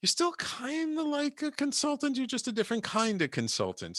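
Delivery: lightly voiced teasing friends; others' reassurance. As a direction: play the friends nudging him gently. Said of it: "they were like".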